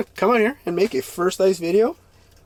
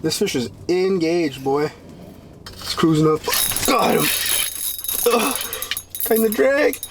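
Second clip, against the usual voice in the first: normal talking voice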